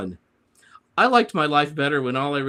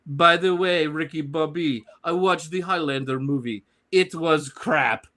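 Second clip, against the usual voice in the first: mocking Jean Girard; 3 semitones higher than usual. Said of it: "Jean Girard".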